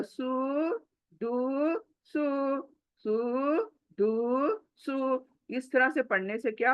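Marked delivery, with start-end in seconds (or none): 0.0-5.3 सु, दु, सु each drawn out long, with gaps; stretched vowels. Shown as a demonstration. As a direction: drag every vowel in सु, दु, सु. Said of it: "ऐसा नहीं पढ़ो". (सु, दु, सु को खींच-खींचकर)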